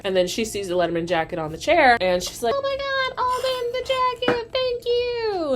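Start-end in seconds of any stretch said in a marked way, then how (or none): 2.6-5.5 high-pitched squealed thanks; gushing thrill. Retold or imitated. Girlfriend spots the jacket on the chair, squealing with delight. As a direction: gush in a very high mock-girlish squeal.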